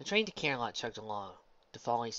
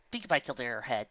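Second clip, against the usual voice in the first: ordinary delivery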